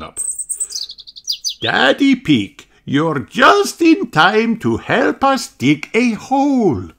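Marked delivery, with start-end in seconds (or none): 1.6-6.9 high, slow, gentle teacher; a kindly schoolteacher speaking. A narrator's reading. Madame Gazelle's lines in the story.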